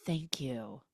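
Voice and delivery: breathy whisper, touched; whispered thanks. Retold or imitated. [name] thanking [name] for the book, a soft whisper. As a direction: whisper it, moved. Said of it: "She's like".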